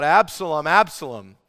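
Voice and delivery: loud anguished cry of a name; crying out in grief. Retold or imitated. Preacher voicing David crying for his son, loud grief. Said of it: "he cries out".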